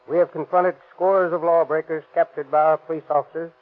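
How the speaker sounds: ordinary talk, nothing marked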